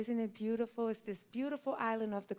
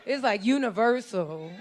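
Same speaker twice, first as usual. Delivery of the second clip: ordinary talk, nothing marked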